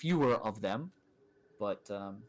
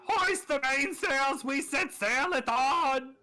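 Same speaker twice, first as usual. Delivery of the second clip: high, slow, loud pirate cry; pirate captain shout; 12 semitones higher, 5 dB louder, slower than usual. Acted. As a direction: bellow orders like a pirate captain. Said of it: "what that translates to is".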